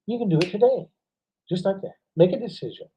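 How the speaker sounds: normal talking voice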